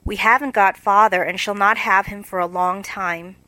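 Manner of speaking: usual speaking voice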